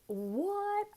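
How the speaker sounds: sharply high, squeaky "What?"; jolted into disbelief; a retold stunned "What?"; shocked. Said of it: "And I was like".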